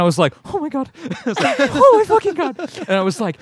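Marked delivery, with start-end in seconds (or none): 0.5-1.0 very high-pitched retold amazement; starstruck gasping awe. Retold starstruck glance at Silva. 1.6-2.6 loud, very high mock squeal; starstruck outburst. Retold starstruck glance at Silva.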